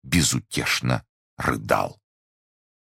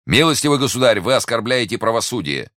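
high, loud outrage; indignant rebuke; 9 semitones higher, 5 dB louder than usual. Acted. Investigator accuses the prisoner of insulting justice, indignant. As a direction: rebuke him with official indignation.

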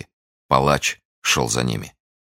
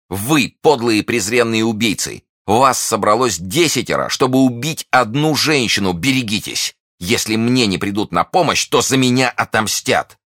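indignant exclamation; 8 semitones higher, 3 dB louder than usual. A narrator's reading. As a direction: hurl the insults at the killers. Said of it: "чтобы воскликнуть". (гневный возглас миледи)

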